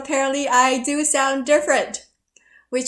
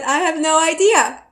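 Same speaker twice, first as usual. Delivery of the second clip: higher and louder, mock exasperated; 7 semitones higher, 8 dB louder than usual. Answering the taxi driver again, louder and exasperated. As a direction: throw it out, fed up with the questions.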